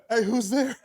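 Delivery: high, giggled words; cracking up. Real, not acted. Storyteller knocking to himself, laughing through it. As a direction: laugh through your own punchline.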